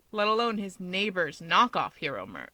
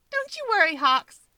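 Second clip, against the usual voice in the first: high, bright grin in the voice; a high grinning promise to Hawks; 12 semitones higher, 6 dB louder than usual. Acted. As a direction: say it through a big grin, much higher. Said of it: "she grins".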